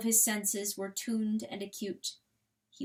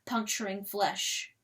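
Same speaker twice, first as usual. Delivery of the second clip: ordinary delivery, nothing marked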